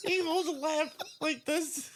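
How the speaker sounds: high, slow, squeezed out through laughter; real laughing. Real, not acted. Riffing on a cop carrying a lamp, helpless laughing. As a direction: barely get it out for laughing. Cracking up at their own bit.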